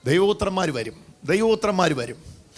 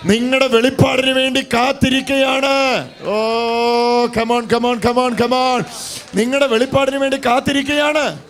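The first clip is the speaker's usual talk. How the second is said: preached at full force, higher and louder; rousing shout; 6 semitones higher, 4 dB louder than usual. Real, not acted. Pastor rousing the church, shouting. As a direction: thunder it to the church.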